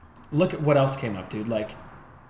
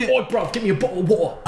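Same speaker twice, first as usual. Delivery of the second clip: high, hammed-up British street accent; exaggerated British lad demanding a drink; mock British sideman; 7 semitones higher, faster than usual. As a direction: widen the words into a cheeky British accent. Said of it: "Just like a sideman".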